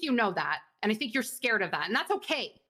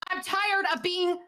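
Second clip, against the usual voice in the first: rising angry pitch, slowed and pressed; fed up, pushing back hard at him; heated complaint to a colleague, voice climbing; 5 semitones higher than usual. Acted.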